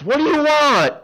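high, loud irritated shout; annoyed neighbor. Acted. Woken neighbor at the window snapping at the caller, high and loud. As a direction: bark it from the window, just woken.

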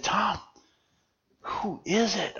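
soft, furtive half-whisper; hushed question. Retold or imitated. Asking Tom who is coming up the canyon, hushed. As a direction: ask it under your breath. Hiding, asks who is approaching.

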